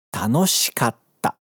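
"Tanoshikatta" slowly, with a silent gap between "ka" and "ta"; slow, geminate pause. Shown as a demonstration. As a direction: hold the stop before "tta" in "Tanoshikatta". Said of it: "tem esse vazio também entre o ka e o ta". ("Tanoshikatta" devagar, com vazio entre ka e ta)

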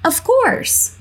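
"Of course" with "course" lifted very high; model intonation. Shown as a demonstration. Teacher showing the airline reply's tune, high-pitched. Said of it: "tentar replicar a entonação".

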